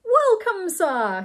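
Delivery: high, loud welcome; good-natured exclamation. A narrator's reading. Butler greets Mister Saltina, good-natured exclamation. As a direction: sing out a hearty greeting. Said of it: "he exclaimed good-naturedly".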